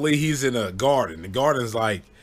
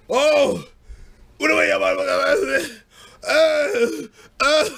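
much higher, loud stunned stammer; dazed uncle; 14 semitones higher, 5 dB louder, slower than usual. Retold or imitated. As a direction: stammer like you just got hit in the head. Uncle dazed after hit.

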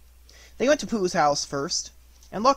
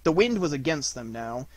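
ordinary delivery

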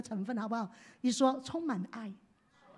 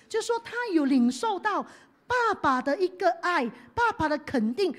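ordinary delivery

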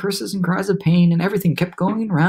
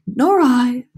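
high, louder emphatic agreement; storybook lament, pitched up; ladybug character; 9 semitones higher, 6 dB louder than usual. A narrator's reading. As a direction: pipe up in a raised ladybug voice. Ladybug joins the complaint.

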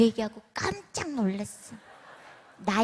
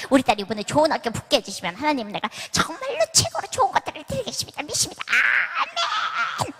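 hammed-up over-the-top prayer; imitating a mother promising God double offerings; fervent praying church lady; 4 dB louder than usual. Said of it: "이쪽에 있는 아줌마가".

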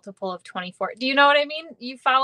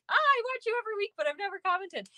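high, quick mock viewer voice; playful high-pitched impression of commenters; quoting viewers; 11 semitones higher, 4 dB quieter, faster than usual. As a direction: chirp it like an excited fan. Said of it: "a whole bunch of you were like".

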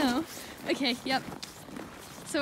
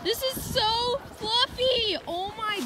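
slow, high, thrilled gush; excited; 7 semitones higher, much slower than usual. Real, not acted. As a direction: marvel at the snow. Delighted by fluffy snow.

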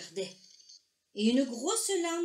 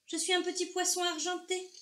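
high-pitched, questioning little character; lightly voiced small fish, lifting at the ends; curious little fish; 6 semitones higher than usual. A narrator's reading. Little fish introduces himself to the big voice, curious.

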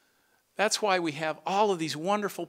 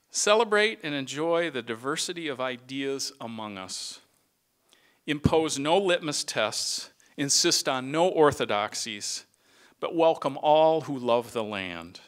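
measured, louder closing blessing, slowing on key lines; benediction; 4 dB louder than usual. Acted. Retiring director blesses his colleagues, solemn and firm. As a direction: send them off like a pastor's blessing.

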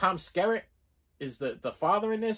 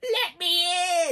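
high, slow coaxing at the door; eerie high-pitched pleading to be let in; creepy old man; 12 semitones higher, much slower than usual. Retold or imitated. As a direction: sweet-talk your way inside, slowly. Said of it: "he wants to come in your house".

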